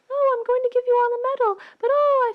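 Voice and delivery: high, fast, loud gush; gushing princess. Acted. Leia handing out medals, high fast gushing.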